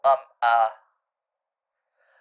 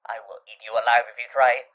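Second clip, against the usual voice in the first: usual speaking voice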